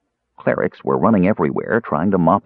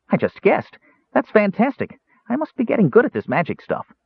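high, thrilled kender voice; a young kender bursting with pride; delighted kender; 13 semitones higher than usual. A narrator's reading.

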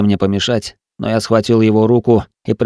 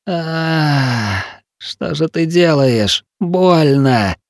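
pained cry, higher voice; 8 semitones higher, much slower than usual. Acted. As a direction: wince through the words. Wounded man in pain during surgery.

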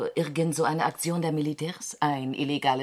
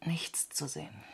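soft disappointed whisper; 3 semitones lower, 10 dB quieter than usual. Acted. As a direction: murmur it under the breath. Peering through telescope, hushed.

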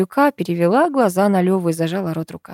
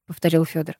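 ordinary delivery, nothing marked

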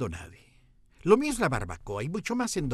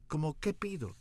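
softer, meek little question; sheepish, softer plea; meekly; 3 semitones higher, 7 dB quieter than usual. Retold or imitated. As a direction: ask timidly, beaten down. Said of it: "pregunté mansamente".

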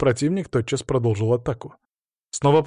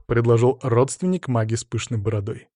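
ordinary delivery

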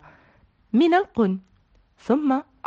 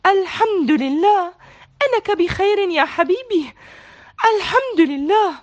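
joyful cry; 9 semitones higher, 4 dB louder than usual. A narrator's reading. Mama relieved her son is safe, loud joyful cry. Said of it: "صاحت ماما بفرح".

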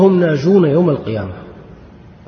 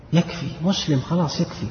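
softly voiced mock dismissal; 3 semitones higher, 6 dB quieter than usual. Preacher mimics those blurring sects, soft dismissive mock. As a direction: imitate opponents waving the question away, softly.

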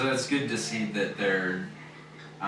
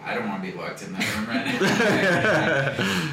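laughing; 7 semitones higher, 7 dB louder than usual. Real, not acted. Joking about being locked in.

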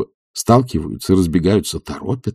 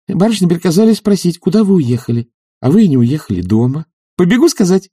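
put-on high-pitched girlish messenger; chirpy high servant girl; young maid; 8 semitones higher, 3 dB louder than usual. A narrator's reading. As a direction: deliver the message in a high, eager voice. Narrator voices the young maid Katya.

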